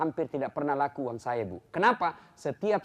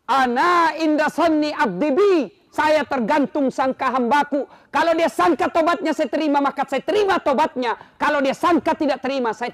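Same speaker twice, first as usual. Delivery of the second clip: loud, high, fiery sermon delivery; full-force preaching; 12 semitones higher, 10 dB louder than usual. Acted. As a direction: preach it to the back row. (khotbah berapi-api dengan suara keras)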